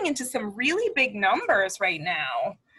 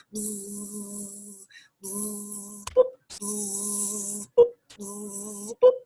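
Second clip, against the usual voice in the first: buzzing game; much slower than usual. Shown as a demonstration. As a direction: play the buzzing therapy game. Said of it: "I used to put my fingers like this".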